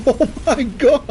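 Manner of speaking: high startled outburst; shocked exclamation. Retold or imitated. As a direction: blurt it out in disbelief. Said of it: "I went".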